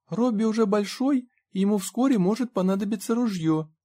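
put-on very high-pitched child's voice; little boy. A narrator's reading. Small boy backing up his friend Robby, high childish voice. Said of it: "подтвердил маленький мальчик".

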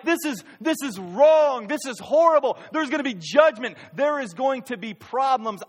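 high, loud indignant King David; angry outburst. Retold or imitated. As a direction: rage at the injustice. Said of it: "He got angry".

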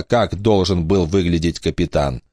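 usual speaking voice

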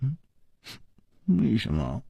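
soft, shy little question; bashful. A narrator's reading. Shi Xiuyun shyly questions Chu Liuxiang, bashful. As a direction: ask it coyly, keeping it small. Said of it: "弄着衣角说".

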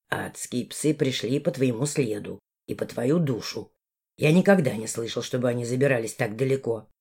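lightly voiced low male, grumbling; Valdemar voice.

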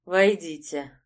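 ordinary delivery, nothing marked

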